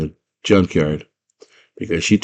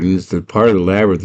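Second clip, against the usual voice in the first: plain reading voice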